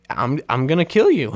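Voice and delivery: half-joking big-sister fury; mock threat. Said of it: "looks at her sister and goes".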